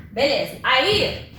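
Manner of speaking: usual speaking voice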